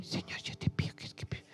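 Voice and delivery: tiny whispered prayer, like a little chick; mock timid prayer. Preacher mimics believers praying like little chicks, timid whisper. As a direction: whisper a meek, mumbled prayer mockingly. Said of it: "orar así como un pollito".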